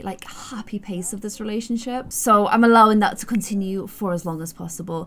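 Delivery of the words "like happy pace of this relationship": soft whispered aside. Real, not acted. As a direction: whisper it gently. Whispered aside about slow pace.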